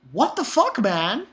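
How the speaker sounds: high-pitched, incredulous protest; exasperated. Acted. Reader fed up with Cohen's ego, exasperated. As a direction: swoop up high in baffled outrage.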